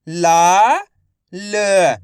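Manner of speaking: ला held long, then ल alone; letter-by-letter sounding. Shown as a demonstration. Teacher for pupils to repeat, ला and ल said separately. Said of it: "आपको मेरे साथ साथ repeat करना है".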